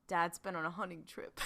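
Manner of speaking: low, quiet Dean mimic; a light take on Dean breaking the news; imitating Dean's cadence. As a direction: drop it low and soft, Dean's clipped cadence. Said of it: "The fact that I can do the cadence".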